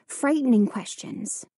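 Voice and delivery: plain reading voice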